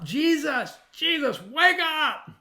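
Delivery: high, loud, frantic yelling over a storm; panicked shout. Retold or imitated. Disciples shouting to wake Jesus in the storm, panicked yell. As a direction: scream him awake in terror. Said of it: "woke Jesus up with their shouting".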